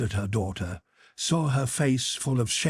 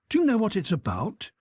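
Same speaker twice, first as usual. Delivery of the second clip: put-on higher, eager young boy; boy's curious question; 10 semitones higher than usual. A narrator's reading. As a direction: ask like a curious kid. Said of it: "Petya asked Natasha".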